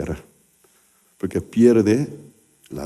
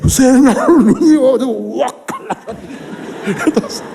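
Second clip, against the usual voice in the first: laughing. Real, not acted. Disgust retelling the surgery.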